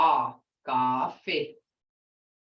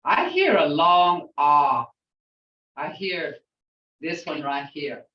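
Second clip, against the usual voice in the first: ordinary talk, nothing marked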